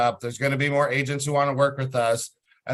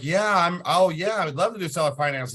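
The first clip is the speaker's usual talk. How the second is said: quick, eager mock-excited agent voice; lightly mocked enthusiasm, a bit higher and rushed; imitating chipper agent; 4 semitones higher than usual. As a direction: play the over-eager agent jumping at the deal. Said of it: "He was super excited about seller finance".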